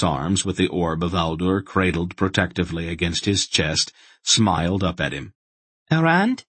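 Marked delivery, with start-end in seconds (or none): 5.9-6.4 high rising query of the word; questioning. A narrator's reading. The little boy in his arms asks, curious. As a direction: ask it up at him, wondering. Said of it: "he asked".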